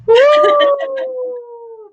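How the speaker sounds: high, loud teary whoo; high cathartic woo; release whoop. Real, not acted. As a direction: shake off the tears with a whoop. Emotional release while crying.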